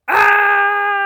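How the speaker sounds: loud, high sustained scream; mock scream. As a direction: scream out in playful panic. Screams at the multiverse happening.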